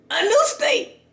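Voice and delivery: very high excited squeal. Real, not acted. Vlogger marvels at moving in hours, excited squeal. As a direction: repeat it as a high-pitched squeal. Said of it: "I'm acting excited".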